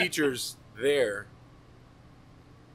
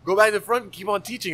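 ordinary delivery

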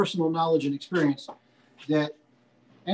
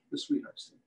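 whispered; 9 dB quieter than usual. Acted. Butcher to his class, repeating today's feature in a whisper. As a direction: repeat the name in a hushed whisper.

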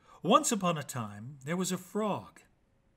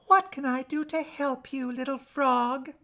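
higher, gentle princess voice; kind princess; 9 semitones higher than usual. Acted. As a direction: speak sweetly down to a little frog. Princess answers the frog.

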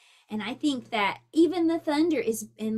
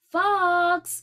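louder, higher call; 6 semitones higher, 8 dB louder than usual. Acted. Mother calling her son home, calling out. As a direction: call across the yard. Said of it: "called his mother".

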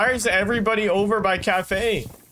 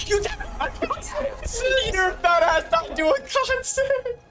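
mocking high voice. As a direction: squeak it out to roast a friend. Laughing at teammate's death.

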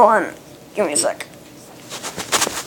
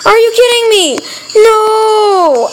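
despairing shout; 11 semitones higher, 4 dB louder, slower than usual. Real, not acted. Losing the level again.

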